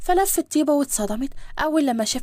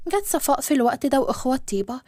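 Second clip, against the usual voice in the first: ordinary delivery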